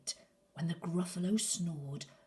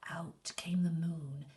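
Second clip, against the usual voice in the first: usual speaking voice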